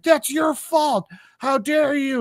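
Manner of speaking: high-pitched indignant accusation; mock outrage. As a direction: play an outraged driver, pitch way up. Angry driver blames Avery.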